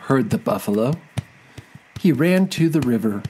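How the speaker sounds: ordinary delivery, nothing marked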